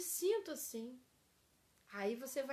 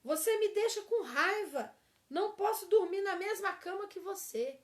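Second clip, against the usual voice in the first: loud, higher angry outburst; angry accusation; 6 semitones higher, 6 dB louder than usual. Shown as a demonstration. Teacher acts the angry spouse leaving the bed, loud. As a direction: snap it out in anger. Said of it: "E o último aqui".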